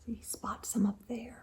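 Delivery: breathy hushed aside; whisper. Real, not acted. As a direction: lean in and whisper it.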